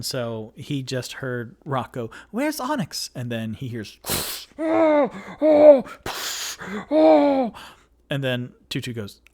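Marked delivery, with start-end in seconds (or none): 2.3-3.1 high-pitched character voice; lifted, put-on questioning voice; Rocco calling out. Game master voices Rocco calling. 4.6-7.5 loud, very high repeated wails; three separate high cries of alarm; high, hollering yelps; overheard screams. Acted. Screams heard from another room.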